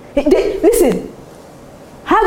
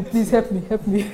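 mock begging; 3 semitones lower, 6 dB quieter than usual. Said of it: "start begging people".

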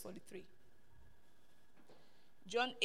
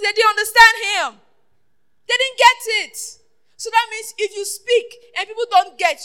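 raised, emphatic sermon voice; full-force preaching; 5 semitones higher, 11 dB louder than usual. Real, not acted. Preacher quoting Jesus to the congregation, full-force preaching. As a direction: press the scripture on the congregation loudly.

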